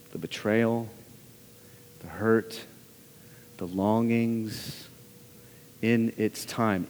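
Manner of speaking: low, soft and slow; name each grief gently, in a low, unhurried voice; hushed and solemn. Real, not acted. Preacher slowly lists congregation's griefs.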